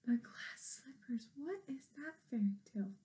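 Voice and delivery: sharp gasp, then a hushed whisper; breathless amazed whisper to the listeners. A narrator's reading. Narrator gasps at fairy tale clue.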